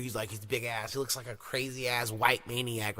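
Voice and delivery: ordinary talk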